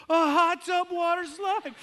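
put-on very high, loud, slow delighted kid yell; thrilled kid shout. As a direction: shout it back in pure delight. Kid echoes dad excitedly.

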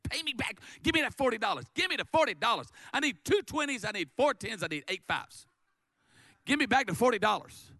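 high, insistent hammering demands; angry demand. Acted. Servant choking his fellow servant over forty dollars, angry demand. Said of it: "he demanded".

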